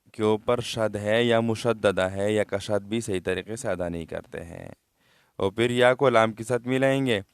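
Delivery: ordinary delivery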